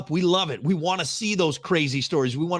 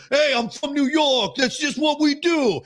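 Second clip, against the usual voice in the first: high, brash New Yorker impression; mock New York accent; 11 semitones higher than usual. Mocking New Yorkers who excuse rudeness, in brash accent. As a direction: sneer it like a smug New Yorker.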